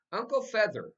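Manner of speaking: put-on high, loud little boy; proud kid. A narrator's reading. Fudge proudly telling the family the bird's name, high loud kid voice. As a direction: announce the name with pride.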